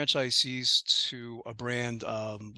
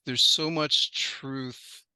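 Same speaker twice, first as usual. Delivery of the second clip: normal talking voice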